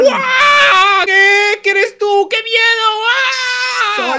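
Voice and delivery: high, loud frightened cries; mock panic. Friend shrieking in fright at the werewolf. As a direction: shriek like you've seen a monster.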